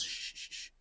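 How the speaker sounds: unvoiced hushing shh shh shh; whispered shushing. Farmer hushes the crane so the snake won't hear, whispered shushing. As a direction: hush him urgently under your breath.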